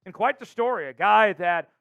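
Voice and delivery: energetic, emphatic sports host delivery; announcer show open. Acted. Host welcomes viewers to Seahawks show, energetic announcer. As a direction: punch key words like a show host.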